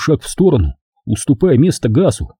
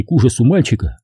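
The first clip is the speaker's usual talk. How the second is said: usual speaking voice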